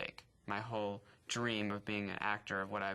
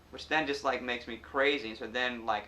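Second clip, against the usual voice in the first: ordinary delivery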